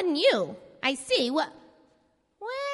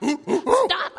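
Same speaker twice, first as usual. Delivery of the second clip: laughing guess; 3 semitones higher, 5 dB louder than usual. Acted. Villain laughs guessing charade word.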